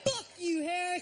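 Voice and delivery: high, loud mock insult; hammed-up defiant yell; taunting outburst. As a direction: throw it triumphantly at a rival. Acts out taunting post to Harris.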